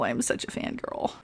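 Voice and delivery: sheepish whispered aside. Real, not acted. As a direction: breathe it out like a confession. Embarrassed aside about being fangirl.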